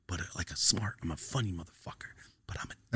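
soft confiding whisper; whispered aside. Real, not acted. Telling his friend what he's like, whispered aside. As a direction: drop to a whisper for the admission.

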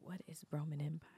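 quick, hushed whisper; whispered question. Real, not acted. Co-host asks what the phrase means, whispering. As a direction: whisper it fast, as an aside.

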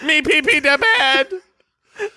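put-on high, slow baby voice, laughing; drawn-out toddler baby talk.

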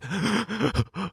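sharp crying gasp; sobbing gasp. Retold or imitated. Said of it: "couldn't even fucking breathe kind of crying".

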